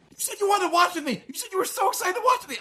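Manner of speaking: very high, squeaky mock protest; whining child impression. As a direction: imitate a hurt kid complaining to dad. Imitating upset child's protest.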